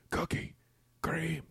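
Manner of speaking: hushed imitation of whispering men; whispered argument. Two dudes arguing over Oreos in a library, whispering. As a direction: whisper it as a mock fight. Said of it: "the Oreo Whisper fight".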